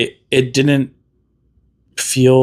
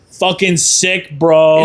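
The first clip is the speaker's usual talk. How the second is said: slow, higher, louder bro; over-the-top bro; 6 semitones higher, 3 dB louder, much slower than usual. Pretend. Guest hams up a hyped bro answer to bad news, slow and higher. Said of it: "over the top".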